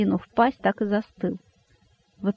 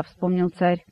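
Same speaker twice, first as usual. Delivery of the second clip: ordinary delivery, nothing marked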